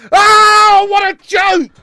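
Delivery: loud, high outburst of disbelief; disgusted shout. Real, not acted. Fan reacting to the equaliser, disgusted shout.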